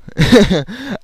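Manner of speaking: high, loud real laugh. Real, not acted. Teller cracks up at his own impression, high laughing. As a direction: burst out laughing after the yell.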